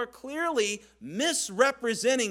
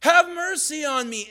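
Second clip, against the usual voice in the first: high, loud desperate cry; screaming out; 8 semitones higher, 7 dB louder than usual. Retold or imitated. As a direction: cry out over the crowd for mercy. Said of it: "screaming out".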